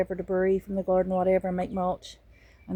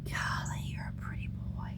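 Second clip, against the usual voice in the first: soft whispered praise; tender whisper to a pet bird; 11 dB quieter than usual. Real, not acted. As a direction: breathe it to the bird.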